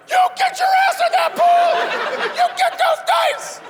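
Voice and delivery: high enraged shriek, voice cracking up; hammed-up squeaky rage; furious shrieking octave jump. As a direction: scream it way up in pitch like a grown man losing it. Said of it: "his voice goes up a couple octaves".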